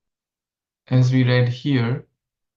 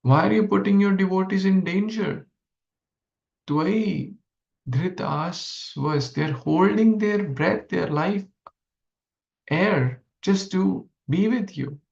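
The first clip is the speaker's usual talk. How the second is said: raised, pleading voice of the gopis; 6 semitones higher than usual. Retold or imitated. Gopis pleading with Krishna about his devotees, raised pleading voice. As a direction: plead higher, as if hurt by his absence.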